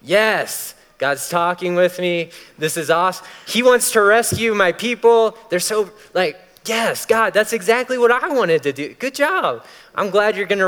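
high, thrilled and eager; excited Moses. Retold or imitated. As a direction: gush like a kid who just got his wish. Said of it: "He's like".

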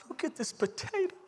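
high, soft, comically pitiful; hammed-up pouty begging; mock unhappy plea. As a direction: plead in a small high voice, pretending to be sad. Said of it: "I will make an unhappy face".

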